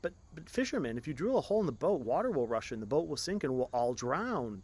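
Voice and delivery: high, loud, rushing panic; panicked warning. Acted. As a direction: hurry the warning out in fear. Passengers warn of sinking.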